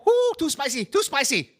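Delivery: very high, loud mock yelp at the heat. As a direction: fan your mouth and squeal. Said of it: "most time they go".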